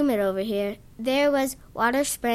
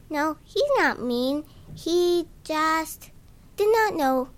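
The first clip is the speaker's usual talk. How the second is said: put-on higher, sweetly forgiving kid; annoying forgiving voice; 5 semitones higher than usual. A narrator's reading. Zinkoff defending the man to the narrator, high and forgiving. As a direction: defend him kindly in a squeaky kid voice. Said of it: "in his annoying, forgiving voice".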